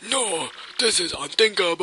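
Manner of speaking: high-pitched, put-on dismay; shocked game character. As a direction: cry out high in theatrical disbelief. Game character reacting in shock, voiced.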